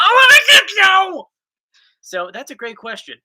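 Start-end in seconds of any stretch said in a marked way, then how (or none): 0.0-1.2 high, loud hammed-up shout imitating wife; mock yelled demand. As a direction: yell it like a needy nightly plea. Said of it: "that's what she does every night".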